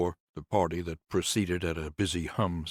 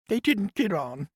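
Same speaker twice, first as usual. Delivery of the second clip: much higher, louder woman's voice, bitter; grieving nurse; 5 dB louder than usual. Acted. Nurse complains to Conrad about the house, higher voice. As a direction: lift into the nurse's aggrieved voice.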